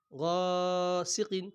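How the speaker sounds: slow chanted গাসিকিন; long, sung-out গাসিকিন; drawn-out recitation. Shown as a demonstration. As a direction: stretch গাসিকিন like tilawat. Quran reading drawn out.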